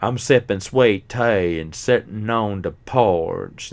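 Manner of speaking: slow, exaggerated Southern drawl; overdone Southern accent. Showing how nobody in the South talks, overdone Southern accent. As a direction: push the Southern accent too hard. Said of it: "pushing a little bit too hard on the Southern accent".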